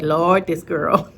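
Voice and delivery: slow, weary mock complaint; exasperated doctor. Doctor seeing her email, mock exasperation. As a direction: lightly voice a doctor who's had enough. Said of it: "she'd be like".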